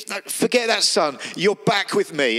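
high, delighted dismissal; overflowing joy, wave the sin away; joyful father. Acted. Overjoyed father hugging returning son.

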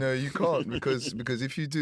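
usual speaking voice

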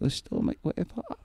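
a high, lightly voiced Fudd-like whine; Elmer Fudd voice. [name] repeating the kicker's question in a high Fudd whine. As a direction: squeak out the stolen-pop complaint. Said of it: "Like that".

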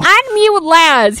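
mock decree. Mock command.

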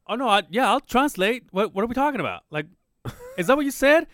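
panicked backpedalling, voice jumping up; flustered backpedal. Retold or imitated. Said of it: "I'm like".

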